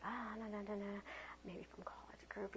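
hushed, dropping into a whisper; whispered puzzling to yourself; private muttering. Retold or imitated. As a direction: think it under your breath. Acting her private puzzled thought.

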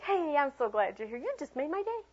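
delight climbing high in pitch; gushing welcome. Shown as a demonstration. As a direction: gush over the child's arrival. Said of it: "you're just like".

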